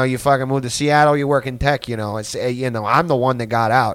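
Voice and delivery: hammed-up Boston townie pleading his case; Boston guy. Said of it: "he was like".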